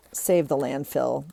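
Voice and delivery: normal talking voice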